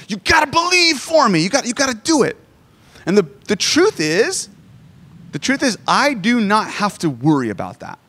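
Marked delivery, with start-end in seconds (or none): 0.1-2.4 pleading, much higher, like a pushy parent; pushy parent plea. Retold or imitated. Parent mock-pleading with daughter to believe for him: high, pushy plea. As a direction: push your kid to believe, voice pitched way up.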